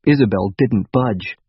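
usual speaking voice

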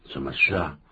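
ordinary talk

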